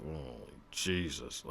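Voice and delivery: soft, low, drawn-out reaction; mock dismay. Comedian reacting to hearing South Carolinians, softly dismayed. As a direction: let it sink out slowly under your breath.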